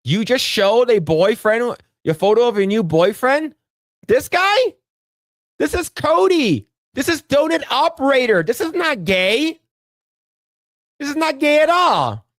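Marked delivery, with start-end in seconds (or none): 4.1-12.2 mocking. Mocking friend's photo.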